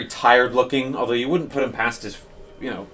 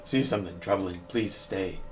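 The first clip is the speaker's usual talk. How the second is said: softer, cracking priest's voice through tears; a touch of a weeping priest, softer; hoarse, broken voice; 2 semitones lower, 9 dB quieter than usual. Acted. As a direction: speak through tears, barely holding together. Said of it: "his voice is just, like, hoarse and, and broken".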